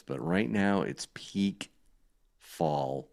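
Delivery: usual speaking voice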